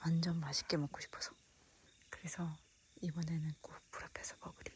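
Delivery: soft unvoiced whisper. Real, not acted. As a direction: hush it so nobody wakes. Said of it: "목소리 크게 안 내서 돼서 좋다".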